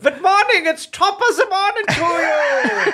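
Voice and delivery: mock Irish accent. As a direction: overdo the Irish cheer. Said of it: "He's a lovely Irish lad".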